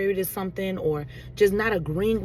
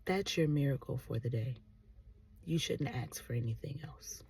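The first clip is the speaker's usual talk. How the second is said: low, hushed voice of God; God's voice; 6 semitones lower, 10 dB quieter than usual. Retold or imitated. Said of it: "I hear".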